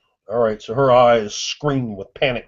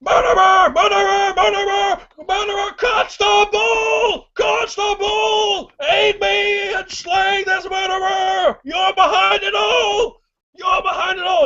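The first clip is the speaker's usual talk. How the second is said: very high, loud, slow screaming accusation; enraged crying shout; 4 dB louder than usual. Acted. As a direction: howl for the constable to kill the murderer. Enraged man calling constable.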